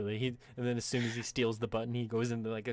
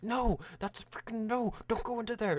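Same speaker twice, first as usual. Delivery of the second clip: high-pitched panicky pleading; scared barbarian; 12 semitones higher than usual. Acted. Scared barbarian warning his teammate off, high and alarmed. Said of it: "The barbarian's scared as shit".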